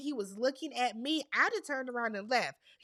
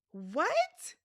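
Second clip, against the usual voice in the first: high rising baffled what; stunned rising question; 4 semitones higher than usual. Real, not acted. Stunned reaction to answer.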